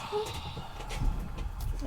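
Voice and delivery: sharp surprised gasp; real gasp of shock; startled gasp. Real, not acted. Book falls on knee.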